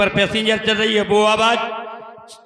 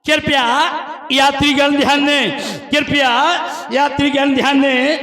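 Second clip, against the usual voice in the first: echoing train announcement; 4 semitones higher than usual. Shown as a demonstration. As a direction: call it out like a platform PA. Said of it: "station master का mic खुला".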